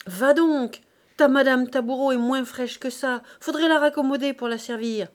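loud, high fishwife insults; jeering market-woman tirade; furious. Acted. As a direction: let rip at the maid. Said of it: "furibondes, les poings en avant, se soulagèrent".

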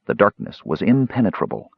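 ordinary delivery

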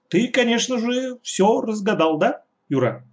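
high, bright-hearted eager question; joyful. A narrator's reading. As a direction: ask him gleefully. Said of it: "спросила она радостно".

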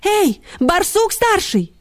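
high, slow hailing; high-pitched drawn-out summons; barked call. A narrator's reading. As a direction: call out into the burrow. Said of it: "гавкнула Ксюня в тёмное отверстие".

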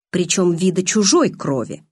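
ordinary delivery

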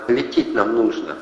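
ordinary delivery, nothing marked

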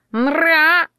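high, loud squawk; drawn-out bird screech; parrot yell. A narrator's reading. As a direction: squawk out like a parrot being snatched. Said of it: "заорал попугай".

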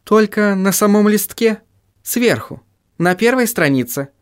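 high-pitched, measured female voice; voiced young woman character. A narrator's reading.

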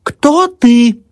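high, loud, urgent question; frantic demand. A narrator's reading. Old woman confronting possessed daughter-in-law, frantic demand. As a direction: cry it out, pitched very high. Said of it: "бросилась старуха к невестке".

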